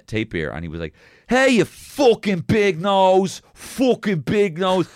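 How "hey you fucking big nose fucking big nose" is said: high, loud, slow taunting yell; mock kid screaming. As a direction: jeer at the tapir like a nasty kid. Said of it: "he was screaming at this".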